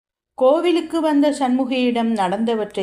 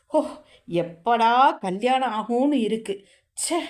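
exasperated; 5 semitones higher than usual. A narrator's reading. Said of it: "அழுத்தபடி Janaki கூற".

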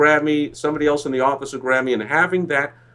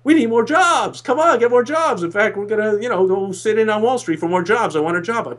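mock ranting voter; 7 semitones higher than usual. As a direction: rant like a worked-up voter. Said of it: "who are you gonna vote for".

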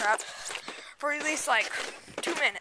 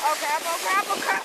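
alarmed; 6 semitones higher than usual. Real, not acted. Sliding out of control.

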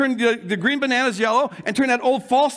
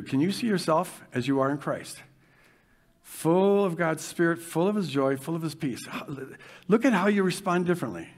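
soft, low, calm guiding voice; quiet meditation-style guidance; guided visualization; 6 semitones lower, 5 dB quieter than usual. Acted. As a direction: lead them gently into the picture.

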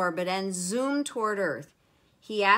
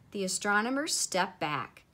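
plain reading voice

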